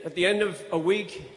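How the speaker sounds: ordinary talk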